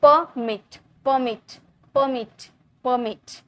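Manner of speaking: परमिट repeated as two beats, "per-mit"; two-beat syllable demo. Shown as a demonstration. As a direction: say each परमिट separately, marking both syllables. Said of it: "ये दो बार sound आ रहा".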